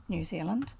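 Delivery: usual speaking voice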